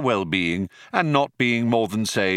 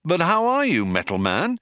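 high-pitched opening, falling away; lightly voiced breezy greeting; 8 semitones higher than usual. A narrator's reading. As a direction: greet the robot with a touch of cheer. Ford cheerily greets gloomy robot.

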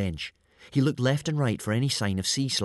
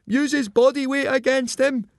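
very high, loud holler; high-pitched ringside shout; shouted advice; 7 dB louder than usual. A narrator's reading. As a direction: call urgent coaching to a struggling friend. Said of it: "he shouted".

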